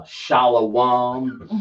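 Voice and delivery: shalawam drawn out slowly, ending in a rising tune; mock the wrong shalawam, slow and rising; imitating the wrong shalawam; mocking wrong pronunciation. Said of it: "they don't understand how to break the Hebrew down".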